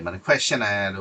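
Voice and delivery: normal talking voice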